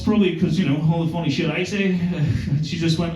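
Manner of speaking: ordinary delivery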